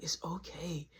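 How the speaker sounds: hushed, imitated comforting; soft consoling murmur. Boyfriend hushes his crying ex, soft and consoling. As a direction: say it low, as if into her ear. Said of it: "I'm just talking in her ear".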